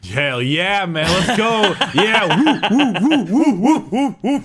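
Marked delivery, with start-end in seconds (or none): 1.9-4.4 high, loud laughing whoops; whooping cheer. Real, not acted. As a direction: whoop it up like a rowdy fan. Cheering for the lady knights.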